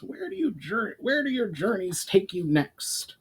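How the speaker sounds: high goblin voice; a goblin chief asking kindly; Brawl, curious goblin. A narrator's reading. Brawl asks.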